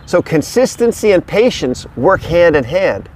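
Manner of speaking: ordinary talk, nothing marked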